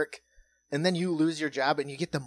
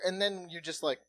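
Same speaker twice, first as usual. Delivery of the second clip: ordinary delivery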